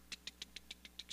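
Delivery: quiet voiceless ticks; imitated ticking. Preacher showing the numbers turning by themselves, imitated ticking. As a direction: tick softly like a little dial turning.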